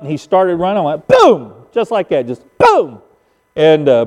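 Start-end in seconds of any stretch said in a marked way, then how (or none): 1.1-1.7 sudden explosive boom; imitated rifle shot. Hunter shoots the running buck, imitated rifle shot. 2.6-3.0 sudden explosive boom; imitated rifle shot. Hunter shoots the running buck, imitated rifle shot.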